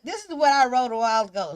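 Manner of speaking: usual speaking voice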